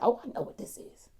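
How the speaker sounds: hushed, whispery grandmother quote; soft whispered retelling of her certainty; whispered knowing reply. Retold or imitated.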